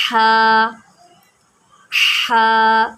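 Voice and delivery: ہا from mid-throat, strong breath; ہا with a firm throaty h, not a light h. Shown as a demonstration. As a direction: push ہا from the middle of the throat. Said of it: "اس لفظ میں غلطی یہ ہوتی ہے".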